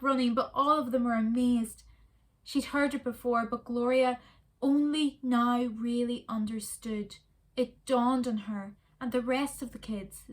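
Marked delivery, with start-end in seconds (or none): none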